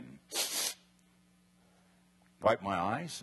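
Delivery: normal talking voice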